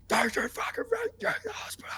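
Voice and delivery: strained high, half-voiced raving; mock whispered screech; imitating crazed rant. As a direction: rant like Dad losing it, voice squeezed thin. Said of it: "Like, just going crazy".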